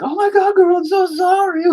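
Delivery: put-on very high, loud baby-talk apology to the dog; squeaky apology. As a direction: gush a squeaky sorry like a crybaby. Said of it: "Then I was the crybaby".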